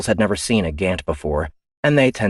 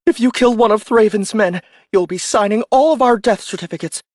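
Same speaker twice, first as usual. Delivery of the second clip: much higher and louder protest; indignant officer; 6 dB louder than usual. Acted. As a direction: push back in disbelief. Ian pleading.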